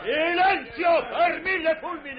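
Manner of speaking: angry shout. Acted. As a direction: silence them with a roar. Capitano zittisce la ciurma.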